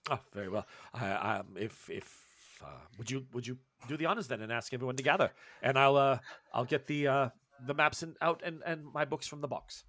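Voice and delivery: low, soft, halting gentleman scholar; hesitant professor, politely deferring. Acted. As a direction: stammer apologetically, keeping it low and soft. Professor answers in character.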